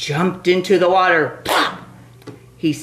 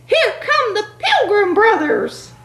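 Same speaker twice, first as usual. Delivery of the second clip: panicky turkey voice; 3 dB louder than usual. Acted.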